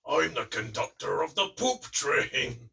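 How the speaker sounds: shouting Krieg the Psycho. Acted. Contestant quoting a game psycho's catchphrase, loud deranged shout. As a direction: shout it out like a madman. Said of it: "he keeps shouting stuff like".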